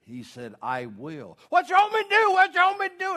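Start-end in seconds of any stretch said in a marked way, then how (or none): 0.6-1.4 brief, solemn heavenly reply; lightly voiced calm divine answer; the Lord. God agrees to help, calm and solemn. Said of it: "a loud voice, just almost like a thunder". 1.5-3.2 very high, loud comic squeal; mock frantic scream. Man on cliff asks God for orders, high mock scream. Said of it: "And he screamed".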